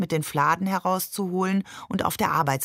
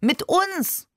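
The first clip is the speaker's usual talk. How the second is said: put-on much higher, pleading child's exclamation; indignant outcry; 11 semitones higher than usual. A narrator's reading. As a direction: cry out to be included. Child protests being left out. (empörter Ausruf)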